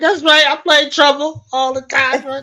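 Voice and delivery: high, loud and gleeful; crowing with childish delight; excited boast. Retold or imitated. Host retelling her own reaction, gleeful and loud. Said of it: "I was like".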